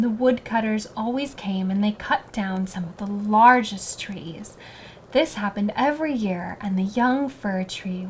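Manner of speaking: ordinary delivery, nothing marked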